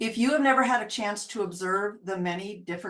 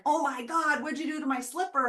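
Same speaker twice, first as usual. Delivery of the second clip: higher, mock scolding owner; mock angry owner; 6 semitones higher than usual. Owner finding a chewed slipper, higher mock scolding. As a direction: scold the dog in a raised mock voice. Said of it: "you come in and you're like".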